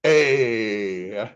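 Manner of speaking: "A" drawn out like the Fonz's "Ayyy"; lightly imitating Fonzie. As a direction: give it a cool Fonzie "Ayyy". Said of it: "it read it like Fonzie would".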